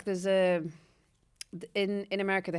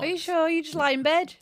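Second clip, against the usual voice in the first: high-pitched mock-sceptical question; teasing disbelief; 10 semitones higher, 3 dB louder than usual. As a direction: tease them with exaggerated disbelief. Teasing challenge to co-host's claim.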